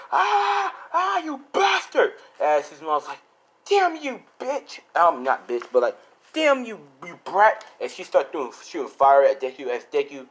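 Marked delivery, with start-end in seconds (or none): 0.1-2.1 pained scream. Acted. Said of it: "He screams". 3.7-4.8 high-pitched mock shriek of rage; angry curse. Acted mother cursing her son. 6.3-7.6 high, drawn-out acted fury; angry curse. Acted mother cursing, word corrected.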